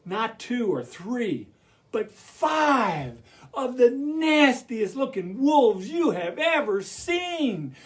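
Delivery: ordinary delivery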